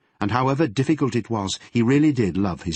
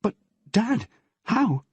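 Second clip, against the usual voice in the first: put-on high, choked girl's voice; startled girl close to tears; tearful surprise; 14 semitones higher than usual.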